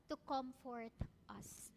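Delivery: gentle. Real, not acted. Preacher softens on key word.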